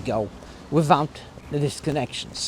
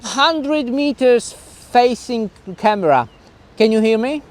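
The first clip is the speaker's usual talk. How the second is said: calling out from afar; 7 semitones higher, 5 dB louder than usual. Real, not acted. Shouting to distant camera outdoors.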